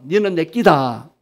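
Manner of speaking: slow Gyeongsang accent; Gyeongsang dialect. Shown as a demonstration. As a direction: lead a repeat in drawn-out Gyeongsang dialect. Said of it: "경상도 사람".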